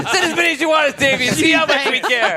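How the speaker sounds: laughing. Acted. Defiant role-played reply.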